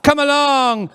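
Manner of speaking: put-on high, loud mum calling her child. Mum at the park summoning Hunter, calling out. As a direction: sing it out across the park. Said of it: "they love calling that name out".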